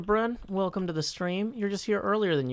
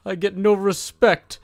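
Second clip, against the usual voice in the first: slow, louder put-on comedian grumble; Rodney Dangerfield impression; 6 dB louder than usual. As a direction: complain like the put-upon comic. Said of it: "this is basically the same voice".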